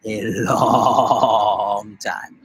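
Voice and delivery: long nostalgic drawl; slow low drawl. Acted. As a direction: drag it out wistfully. Wistful echo.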